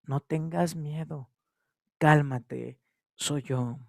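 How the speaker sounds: low, soft and calming, as Jesus. Acted. Jesus calming a frightened Peter, soft and low. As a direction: soothe the frightened disciple gently. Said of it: "la voz de Jesucristo".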